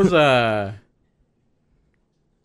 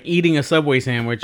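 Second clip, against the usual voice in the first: ordinary delivery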